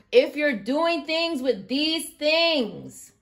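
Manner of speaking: loud, high, slow furious yelling; real outburst, shouting it out; furious rant. Real, not acted. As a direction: blow up at the cheaters, hammering each word. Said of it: "Let me calm down".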